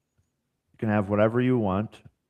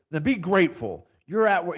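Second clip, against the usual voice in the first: coach ripping into him; 8 semitones higher, 5 dB louder than usual. Retold or imitated. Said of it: "he just ripped me".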